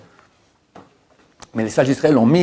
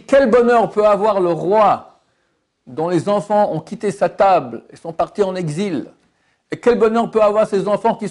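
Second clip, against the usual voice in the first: God's roar; 7 semitones higher than usual. Acted. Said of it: "Une voix rugit dans le ciel".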